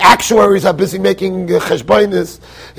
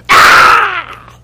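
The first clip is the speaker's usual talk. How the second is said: high, loud mock baby cry; a hammed-up infant shriek at three in the morning; baby's wail; 11 semitones higher, 5 dB louder than usual. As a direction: wail like a newborn demanding milk. Baby waking mother at night.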